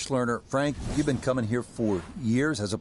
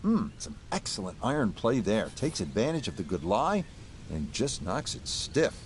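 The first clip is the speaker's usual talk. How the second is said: hushed, measured golf commentary; golf commentator; 5 dB quieter than usual. Acted. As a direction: softly call the shots like a TV broadcaster. Live golf play-by-play, hushed.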